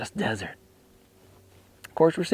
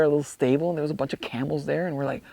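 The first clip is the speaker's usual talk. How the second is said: normal talking voice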